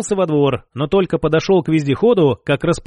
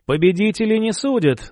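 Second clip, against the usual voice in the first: ordinary delivery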